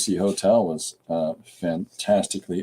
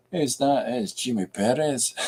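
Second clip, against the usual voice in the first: lightly imitated North Scotland accent; Scottish accent; 5 semitones higher than usual. Streamer quoting a TV detective show, imitated Scottish accent. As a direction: mimic a Scottish speaker saying the name. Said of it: "in North, North Scotland".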